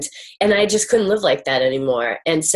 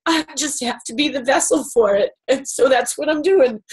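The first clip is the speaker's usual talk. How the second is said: higher voice, on the edge of tears; tearful; 5 semitones higher than usual. Real, not acted. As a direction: speak through welling tears. Emotional confession after a breakthrough.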